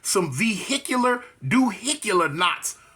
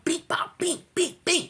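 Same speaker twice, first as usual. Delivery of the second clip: punch noises; 11 semitones higher, slower than usual. Retold or imitated. Blows imitated.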